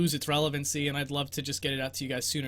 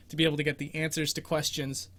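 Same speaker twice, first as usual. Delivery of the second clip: ordinary talk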